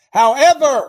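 high, loud turn of the sermon; booming high however; loud emphatic however. Real, not acted. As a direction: hit it loud like a pivot. Preacher building to a contrast.

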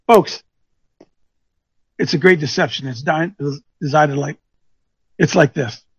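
ordinary talk, nothing marked

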